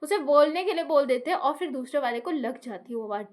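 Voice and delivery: ordinary delivery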